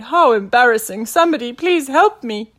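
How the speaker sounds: embarrassed Admiral calling for rescue; crying out. A narrator's reading. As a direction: cry out in mortified distress. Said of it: "the Admiral cried".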